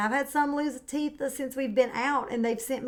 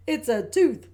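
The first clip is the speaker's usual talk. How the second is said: laughing; 3 semitones higher, 6 dB louder than usual. Acted. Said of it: "Daddy Pig laughed".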